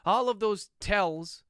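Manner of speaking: reading aloud, speaking the punctuation; dictating. Acted. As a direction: dictate it, naming every comma and period.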